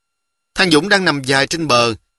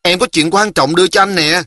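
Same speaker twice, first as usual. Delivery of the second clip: put-on higher, eager little-girl voice; impatient, eager; 5 semitones higher than usual. A narrator's reading. Girl has something important for her cousin, speaking eagerly. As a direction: press your important news on him. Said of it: "nôn nóng gọi".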